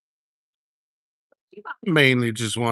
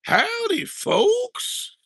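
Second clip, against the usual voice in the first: very high, whooping howdy; signature howdy greeting; much slower than usual. Acted. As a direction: sing it out high as a catchphrase. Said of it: "Got my signature thing there".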